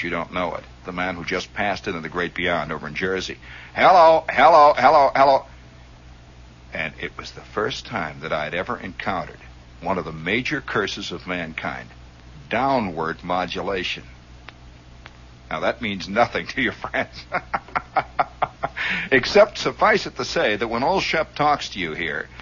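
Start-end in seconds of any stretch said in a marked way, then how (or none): 3.7-5.4 high, loud repeated test calls; loud mic test. Retold or imitated. Ham retesting a faulty transmitter, calling high and loud. 17.3-19.0 high, airy run of chuckles. Real, not acted. Storyteller laughing at his technical aside, chuckling.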